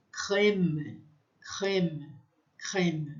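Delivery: crème with an open è; French crème. Shown as a demonstration. Teacher drilling crème with students, open vowel. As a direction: repeat crème, open è each time. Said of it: "ele é aberto".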